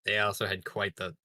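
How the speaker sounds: normal talking voice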